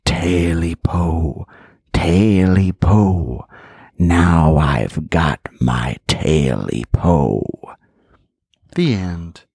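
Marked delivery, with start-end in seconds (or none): 0.0-7.4 slow, low creature whisper; eerie whisper. Acted. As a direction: breathe it out like a ghostly creature. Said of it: "the whisper".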